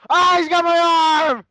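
high, loud, slow screamed words; real cry of pain and terror; terrified scream. Real, not acted. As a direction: scream it as you are being mauled. Caller seized by the bear.

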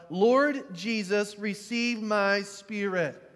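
slow, loud cry to heaven; slow, loud, pleading call. Retold or imitated. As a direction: call it out slowly toward the sky. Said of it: "he called out".

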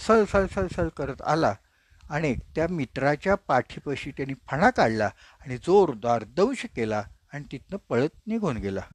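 ordinary delivery

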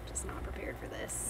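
whisper. Real, not acted. Dreading the hot wings.

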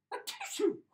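loud, high-pitched "atishoo" sneeze; sudden sneeze from dust; acted sneeze. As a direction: explode into a big pretend sneeze.

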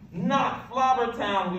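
slow, high, loud dismay; shouted protest. A narrator's reading. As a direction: cry it out in horror, drawn out. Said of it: "we shouted".

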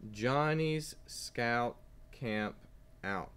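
put-on each word drawn out slowly for kids; slow title reading. Shown as a demonstration. Teacher reading a book title to young students, slow and clear. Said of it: "Let's read the title one more time".

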